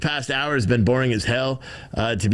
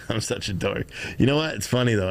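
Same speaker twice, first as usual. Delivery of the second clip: ordinary talk, nothing marked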